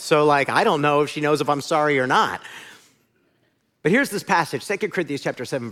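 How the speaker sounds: ordinary talk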